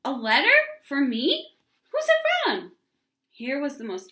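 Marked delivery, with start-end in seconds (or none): 0.0-2.7 high, loud, thrilled questions; a letter, for me? bursting with excitement; excited child. A narrator's reading. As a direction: leap at the news like an eager child. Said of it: "Ramona jumped up from the table".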